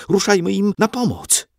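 put-on high, urgent boy's plea; alarmed urging. A narrator's reading. Frightened boy begging to help the troops, high and urgent. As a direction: urge them on in alarm. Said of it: "przeraził się Andrzej".

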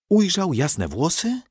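ordinary delivery, nothing marked

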